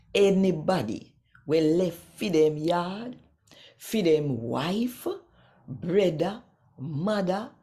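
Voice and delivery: ordinary talk, nothing marked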